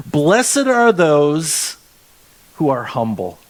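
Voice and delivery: drawn out, savouring each word; slow, relishing the verse; slow emphatic reading. Shown as a demonstration. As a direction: read it slowly, letting it land. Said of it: "Isn't that good? I really like that".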